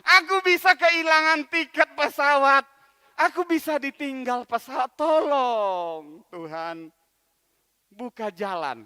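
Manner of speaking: pleading loudly to God; frantic shouted plea stuck in traffic; desperate loud prayer. Acted. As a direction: beg God for help at full voice. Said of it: "Dia berdoa kepada Tuhan".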